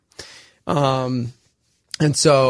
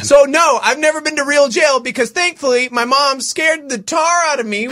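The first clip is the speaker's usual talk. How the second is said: excited shouting; 9 semitones higher, 4 dB louder than usual. Real, not acted. Still shouting, high in bit.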